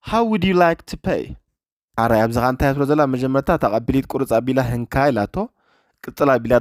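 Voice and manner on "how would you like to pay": slow, careful English with stress on room; slow repeat for learners. Shown as a demonstration. Teacher repeating a clip line slowly for students, slow and careful. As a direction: say it slowly so the class can copy.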